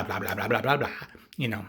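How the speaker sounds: usual speaking voice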